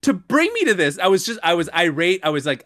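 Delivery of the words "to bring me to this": higher, mock-angry complaint to family; retold indignant complaint. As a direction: whine it like a wronged kid. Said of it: "I'm like".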